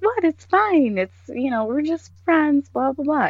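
high, breezy dismissive voice; imitating the coworker's airy excuse; mocking coworker. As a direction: brush it off like it's nothing.

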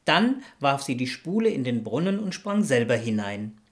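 ordinary delivery

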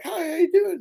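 high, sing-song fake politeness; fake friendly greeting. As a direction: put on a phony sweet hello. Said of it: "when someone comes up to you and they're fake".

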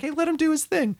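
high, easygoing grandma voice; calm grandma. Retold or imitated. Grandma telling others to back off, high and easygoing. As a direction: shrug it off for the kid. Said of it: "She'd be like".

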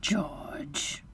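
soft, urgent whisper; hushing whisper. A narrator's reading. Wife hushes husband, whispered. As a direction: whisper the name to check him.

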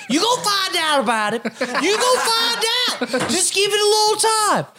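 high mocking voice, Pete's taunt. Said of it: "All he would say was".

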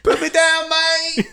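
put-on high, loud scream with a failed British accent. Dog screaming at the hawk carrying him off, in a failed British accent. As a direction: shriek for help in a bad British accent. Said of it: "You didn't have a British scream at all".

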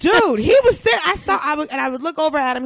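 high, loud animated storytelling; excited. Real, not acted. Telling friend about the stunned dude, excited outburst. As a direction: burst out with it, then ease slightly.

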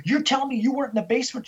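high, rapid disbelieving challenge; snapped, incredulous. Retold or imitated. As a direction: throw it back at her, fast and high. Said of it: "he just snapped".

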